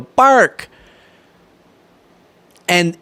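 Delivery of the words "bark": put-on little kid voice. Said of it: "And they go".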